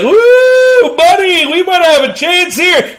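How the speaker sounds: high, loud mock whoop of delight; gleeful rival. Missouri seeing the sleepy opponent, mock gleeful whoop. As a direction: cheer like the underdog who smells a chance. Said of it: "Missouri's like".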